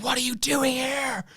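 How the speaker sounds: high, slow stern demand; a stern principal snapping the question; principal's sharp challenge. Retold or imitated. Principal confronting teacher, retold.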